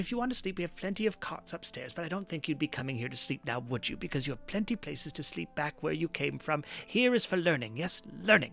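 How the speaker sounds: put-on old Kender librarian. Librarian gets a visitor up off the ground, fussy old voice. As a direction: gently chivvy them along in an old woman's voice.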